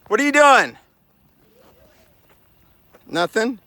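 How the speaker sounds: high, loud challenge; calling out suspicious. Pretend. Hiker calling to someone sneaking, high suspicious shout. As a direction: call out to catch them.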